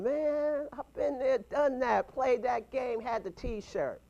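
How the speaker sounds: ordinary talk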